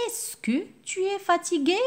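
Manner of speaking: high, clear model question; teacher's model question. Shown as a demonstration. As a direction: read it out as the example for pupils. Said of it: "j'utilise l'expression «est-ce que»".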